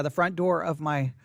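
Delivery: ordinary delivery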